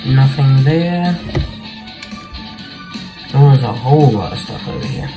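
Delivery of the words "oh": ordinary talk, nothing marked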